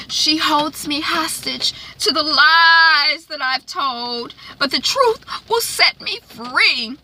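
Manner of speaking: high, drawn-out melodramatic mock preaching; hammed-up pious mother, stretching the big words; preacher's declamation. Acting out the preacher mother's speech.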